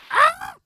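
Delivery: very high loud shriek; a sudden shocked cry; startled shriek. Acted. A character is struck by the revelation, shrieking.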